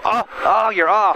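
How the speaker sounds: startled examiner. Retold or imitated. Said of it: "and he'd go".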